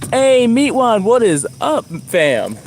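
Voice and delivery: high, loud, cheerful hello; excited greeting. Real, not acted. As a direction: greet a friend with a burst of energy. Greeting a chat viewer excitedly.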